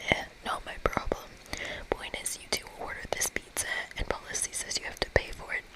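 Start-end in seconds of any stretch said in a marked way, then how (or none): none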